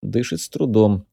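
ordinary delivery, nothing marked

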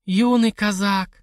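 slow, high and relishing; savoring, drawn out. Acted. Widow relishes hearing of young Cossack, slow and high. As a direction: roll the words with appetite. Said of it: "спросила Зизи".

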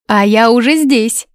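high, loud cheerful exclamation; exclaiming. Acted. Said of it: "воскликнула Даниза".

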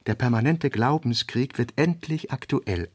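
usual speaking voice

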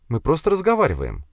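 put-on high, girlish explanation; anxious girl placating. A narrator's reading. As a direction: placate your brother, pitched high. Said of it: "затараторила девушка".

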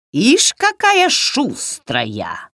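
slow, high witchy grumble; crotchety, drawn-out witch voice; grumbling Baba Yaga. A narrator's reading. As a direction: drag it out like a grumpy old witch. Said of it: "буркнула Баба Яга".

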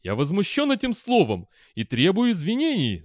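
high-pitched indignant outburst; thundering. A narrator's reading. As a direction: thunder your outrage. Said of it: "загремела Мадира".

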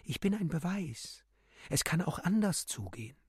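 high, restrained bird voice; muted bird cry. A narrator's reading. The bird speaks to frightened people, a muted high cry. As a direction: cry it lightly from up high, without volume. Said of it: "Der Vogel schrie in Graurot".

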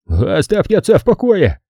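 high, strained outburst; hoarse protest. A narrator's reading. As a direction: croak it out in his defence. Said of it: "прохрипел Эдгар". (хриплый протест, высоким голосом)

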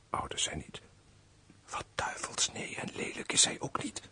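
low, hushed murmur to oneself; private aside. Acted. Major privately sizing up the young lady, private aside. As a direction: appraise her under your breath.

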